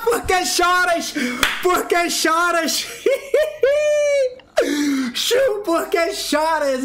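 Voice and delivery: high, drawn-out taunt through laughs; squealing with triumphant laughter; gloating laughter. Real, not acted. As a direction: crow slowly over the losers, cracking up. Gloating after a win, laughing.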